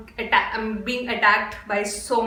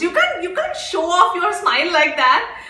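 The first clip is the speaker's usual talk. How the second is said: swooning; 6 semitones higher, 3 dB louder than usual. Real, not acted. Swooning at smile.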